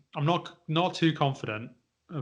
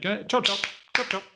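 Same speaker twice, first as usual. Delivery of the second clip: put-on mum hurrying a child; 6 semitones higher than usual. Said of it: "the kind of thing that your mum would say".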